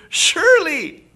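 high, drawn-out knowing surely; a crowd sure of him; accusing bystander. Acted. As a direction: say it like you've caught him.

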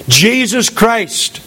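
ordinary talk

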